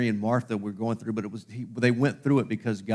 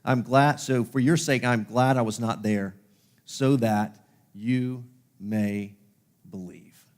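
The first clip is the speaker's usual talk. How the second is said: slow, weighty reading; 3 dB louder, slower than usual. Shown as a demonstration. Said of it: "Look at what it says".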